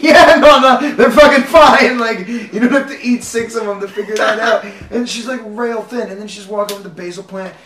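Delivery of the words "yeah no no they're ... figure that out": loud, high imitation of an insistent grandma; grandma's indignant comeback, hammed up; mock loud retort. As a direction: retell it as an exasperated, raised-voice brush-off. Grandma brushing off a complaint, retold.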